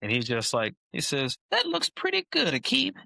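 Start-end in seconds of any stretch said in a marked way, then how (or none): 1.5-2.9 squeaky high mock coach voice; a gruff coach suddenly sounding impressed, pitched way up; Mangino impression. Coach praising Aqib's gray spats in a high mock voice. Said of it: "Your Mangino impression is hilarious".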